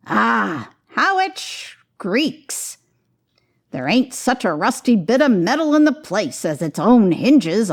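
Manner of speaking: put-on a sly old rag dealer welcoming them in; cackling old fence inviting them in; old Joe, wheezy dealer. A narrator's reading.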